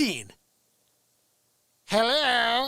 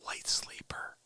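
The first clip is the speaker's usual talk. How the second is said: even softer whisper; quiet at night; 12 dB quieter than usual. Retold or imitated. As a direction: whisper for the light sleeper. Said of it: "I have to talk even lower".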